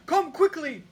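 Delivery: urgent high cry for help; king crying out on the phone, high and loud; cried out. A narrator's reading. King calls the detective in panic, loud high cry. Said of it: "he cried".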